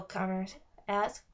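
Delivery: plain reading voice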